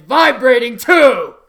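loud punch on the last words; sudden loud finish. Acted. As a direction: bark the ending out. Sudden loud end of title.

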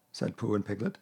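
usual speaking voice

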